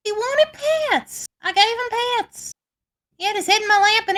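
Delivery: very high, excited squeal; gushing delight. Acted. Acted delight at cuddling displacer beast.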